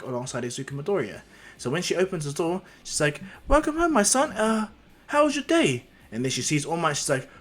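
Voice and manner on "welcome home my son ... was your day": high, louder motherly welcome; high-pitched acted mum voice; greeting mother. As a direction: play a mother welcoming her child home. Mother greets son at door.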